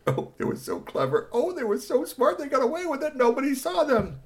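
very high sing-song mockery; mock smug. A narrator's reading. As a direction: tease them for thinking they're clever. Mocking the villains' smugness.

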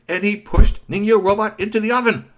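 high and loud, building excitement; dramatic narration. A narrator's reading. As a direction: tell it like a big reveal. Dramatic storytelling climax.

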